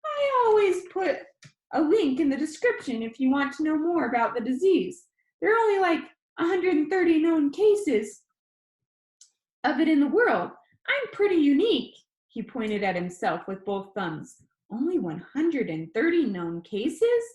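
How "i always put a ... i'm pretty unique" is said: high, loud, chatty vlogger; high squeaky boy. Acted. Danny tells his viewers about his disease in a high squeaky voice. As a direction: rattle it off like an upbeat kid vlogger. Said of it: "His voice was high and squeaky".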